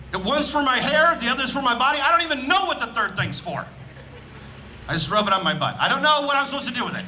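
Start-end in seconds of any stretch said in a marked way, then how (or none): none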